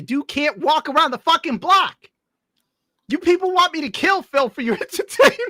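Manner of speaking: high-pitched incredulous protest; comic outrage. Acted. Streamer protesting to people who want the boxing match, comically outraged. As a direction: voice climbing high, laughter creeping in.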